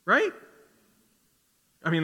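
ordinary talk

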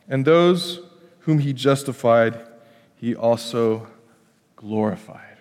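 scripture reading. Acted. Reads Romans aloud.

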